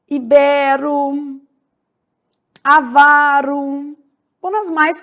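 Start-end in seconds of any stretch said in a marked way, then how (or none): 0.1-1.4 slow Ibero, i-BÉ-ro; stress on BE. Shown as a demonstration. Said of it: "a sílaba tônica". 2.6-4.0 slow avaro, a-VA-ro; stress on VA. Shown as a demonstration. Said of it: "a sílaba tônica".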